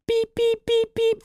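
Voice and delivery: very high-pitched vocal beeping; imitated alarm beeps. Traveler mimicking the check-in kiosk's alarm, high beeps. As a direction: mimic a shrill electronic alarm with the voice.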